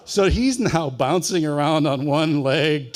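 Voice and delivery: ordinary talk, nothing marked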